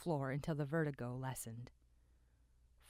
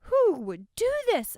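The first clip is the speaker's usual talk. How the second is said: much higher, loud; a shrill-pitched bystander calling out; a high, loud worried cry; another voice; 15 dB louder than usual. A narrator's reading. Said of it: "another voice popped up".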